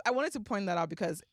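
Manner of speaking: ordinary talk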